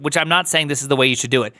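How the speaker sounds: ordinary talk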